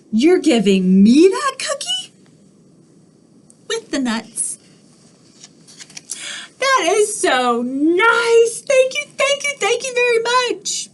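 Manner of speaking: high, gushing thank-you pigeon; a bird suddenly overjoyed; thrilled cartoon pigeon; delighted pigeon.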